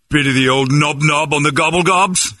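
high, slow cartoon English accent; mock British accent. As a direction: tease with a silly English accent. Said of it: "we do that too".

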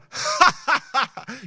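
high, scornful laugh in his face; a demo laugh to shrug off an insult; mocking laugh. Shown as a demonstration. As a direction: laugh right at him to show it can't hurt. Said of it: "And you go".

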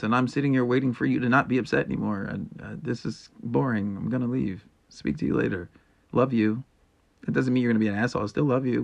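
normal talking voice

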